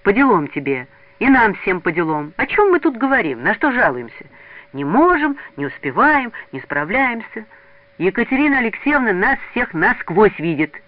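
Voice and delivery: put-on heated boy taking the group to task; cross scolding. A narrator's reading. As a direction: scold your friends in earnest. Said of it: "сердито дёрнул его за руку".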